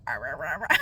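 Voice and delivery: low monster-like mock croak, climbing; wordless low babble of a failing voice; sick, lost voice. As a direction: imitate a sick voice with growly rahs. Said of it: "You can hear it in my voice".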